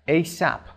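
A S A P run together as "ay-sap"; said as a word. Shown as a demonstration. Teacher showing learners the acronym said as one word. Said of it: "occasionally pronounced as a word".

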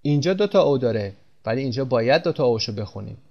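ordinary talk

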